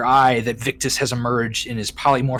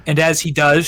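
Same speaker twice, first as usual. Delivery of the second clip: ordinary talk, nothing marked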